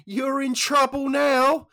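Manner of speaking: put-on very high, loud old-lady crow; gleeful granny's warning; little old dear. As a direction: stretch it out slowly, squeaky and loud. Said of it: "And the old deer went".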